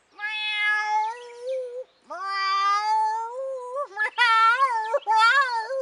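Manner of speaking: loud, high, drawn-out meowing; tomcat yowl. A narrator's reading. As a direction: yowl like an old tomcat up a tree. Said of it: "It was an old tomcat".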